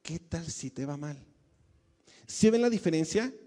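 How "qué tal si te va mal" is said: quiet worried voice. Acted. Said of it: "Y la ansiedad dice".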